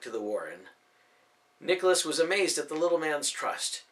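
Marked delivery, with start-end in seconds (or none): none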